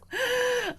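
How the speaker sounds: sharp high gasp of delight; gasp, thrilled by what you just heard; a high gasp of delight at the co-host's voice. Real, not acted.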